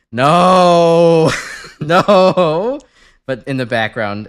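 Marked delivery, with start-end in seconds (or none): 0.1-2.8 loud, drawn-out high cry; mock-dramatic refusal. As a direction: wail it out in exaggerated protest. Mock refusal of the chat's suggestion.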